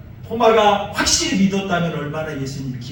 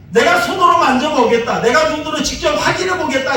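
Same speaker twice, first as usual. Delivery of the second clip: insistent, higher, louder voice of the doubter; doubting demand; 6 semitones higher, 4 dB louder than usual. Retold or imitated. Thomas insisting to the disciples, demanding. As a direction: demand proof before believing. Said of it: "이렇게 말했던 사람입니다".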